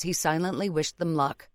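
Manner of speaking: plain reading voice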